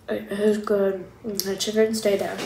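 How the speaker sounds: eating with fingers; talking while chewing. Real, not acted. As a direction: mouth partly full. Seen 1: Eats chicken before and mid-speech; fingers at mouth near 2.5-3.4.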